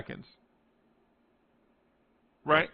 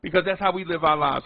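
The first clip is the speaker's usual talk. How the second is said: ordinary delivery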